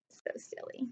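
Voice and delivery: soft unvoiced whisper; hushed amused aside. Real, not acted. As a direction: breathe the words as an aside. Teacher whispers aside to kids.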